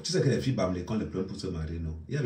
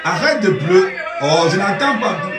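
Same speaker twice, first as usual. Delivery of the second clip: high, loud exasperated shouting; loud scolding; 6 dB louder than usual. Acted. Yelling at the professor to respect himself, loud. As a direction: shout him down, fed up.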